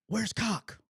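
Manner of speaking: high, sharp-pitched mock demand; angry coach demanding. Head coach looks for strength coach, retold irate demand. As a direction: imitate an irate boss asking who's missing.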